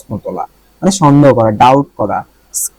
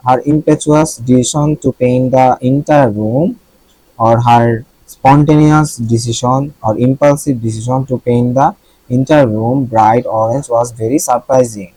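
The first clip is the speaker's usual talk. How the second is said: slow dictation reading; much slower than usual. Shown as a demonstration. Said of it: "reads example sentence slowly".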